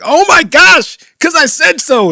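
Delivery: loud, high mock exasperation; exasperated parent. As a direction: snap like a parent giving up on questions. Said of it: "when you finally give up".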